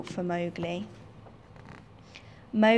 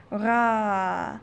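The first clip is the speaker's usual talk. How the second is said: bear roar; 3 dB louder than usual. Acted. Animal roar in the rescue.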